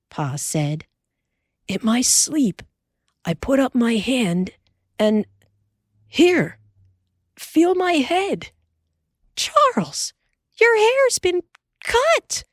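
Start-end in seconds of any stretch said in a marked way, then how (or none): 1.7-8.5 a touch of urgency, higher; Pa insisting. Acted. Pa urgent in the dark. 9.3-12.4 shocked whisper rising to a cry, much higher; wife discovering his hair cut in the night; exclaimed. Acted. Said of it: "Ma exclaimed".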